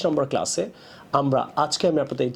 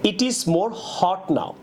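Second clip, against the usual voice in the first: slow demo of wrong sentence; 4 semitones higher, much slower than usual. Shown as a demonstration. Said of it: "এটা না বলে".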